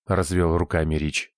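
plain reading voice

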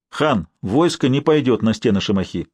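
usual speaking voice

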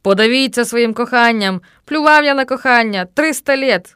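loud, hateful curse. A narrator's reading. Narrator curses love in a rival's thoughts, hateful. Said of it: "з ненавистю цідив я крізь зуби".

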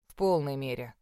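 low, certain reassurance; firm husband voice. Acted. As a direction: say it like a man who won't budge. Aiden reassures firmly.

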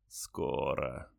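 very slow, low dying rasp with a smile; faint deathbed whisper. A narrator's reading. Dying soldier repeats.

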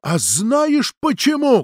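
high, slow challenging cry; shouted retort. A narrator's reading. Natalia yells back at her friend, shouted retort. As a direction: fling the question back, stretched out. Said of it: "крикнула в ответ Наталья".

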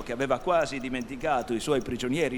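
ordinary delivery, nothing marked

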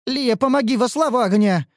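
high desperate plea for help; pleading cry. Acted. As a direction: beg for rescue, voice lifted. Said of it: "взмолилась красная".

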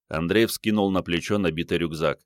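ordinary delivery, nothing marked

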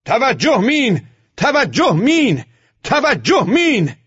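slow, drawn-out high shouts; shouting together. A narrator's reading. Squad warning the driver of mines, shouting. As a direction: holler each word long and loud. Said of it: "همه دور هم جمع شدیم و داد زدیم".

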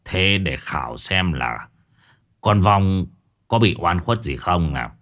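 lightly voiced old master, squinting; sly old master. A narrator's reading. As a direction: Master Phong squinting, testing the ghost, low and sly. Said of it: "Ông thầy Phong híp mắt".

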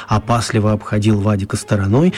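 usual speaking voice

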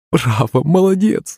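high-pitched delighted praise; cheering cry. A narrator's reading. Mother applauds father's victory, cheering. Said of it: "закричала маман".

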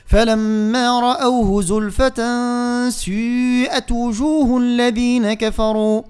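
slow melodic tajweed chant; Quran recitation. Shown as a demonstration. Teacher chanting the verse for students, slow and melodic.

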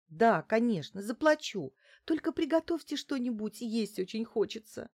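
high, pleading little voice; plaintive plea. A narrator's reading. Client asking the housekeeper for food, plaintive. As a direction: beg pitifully, pitch lifted high. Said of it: "жалобно попросила Лариса".

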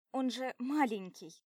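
loud indignant protest. Acted. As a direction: protest it loudly. Defending him.

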